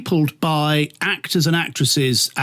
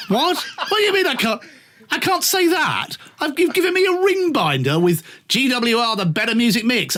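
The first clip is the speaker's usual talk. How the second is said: higher, disbelieving outburst; incredulous protest; 9 semitones higher than usual. Retold or imitated. Retelling an argument with a boss, incredulous. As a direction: sound scandalised, half laughing.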